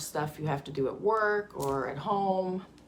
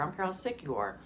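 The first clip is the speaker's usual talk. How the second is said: normal talking voice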